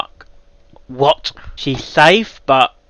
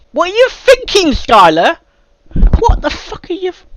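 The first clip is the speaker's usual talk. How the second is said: high, loud scolding of the writer; exasperated outburst; 7 dB louder than usual. Real, not acted. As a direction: berate the author in disbelief. Scolding the story's author.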